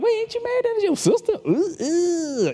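high, mock-scandalized outsider; high shocked busybody voice. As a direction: squeal it like a scandalized gossip. Outsiders hear brother and sister.

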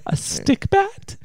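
high, slow disbelieving question; incredulous. Real, not acted. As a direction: ask it in total disbelief. Baffled at the term stick bat.

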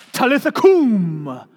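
slow, loud, intoned spell; mock magical incantation. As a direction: chant it like a hammed-up magic spell. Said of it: "as if it's a magical incantation".

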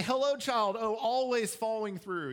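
high, loud mock hail; exaggerated, ringing sarcastic praise; sarcastic greeting. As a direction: greet the child like grand royalty, sarcastically. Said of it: "I'm being sarcastic when I'm doing that".

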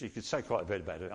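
rushed, fast aside; quick hurried throwaway; hurrying. Real, not acted. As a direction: race through it to save time. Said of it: "I'm hurrying through this".

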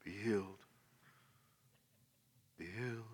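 very soft, low pronouncement; mock hushed film-style healing; solemn movie healer. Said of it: "it's like movies goes".

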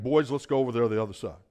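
quick, offhand everyday talk; fast casual rewording of Jesus; casual paraphrase. Retold or imitated. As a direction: toss it off fast like a buddy. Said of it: "He said something to the effect of".

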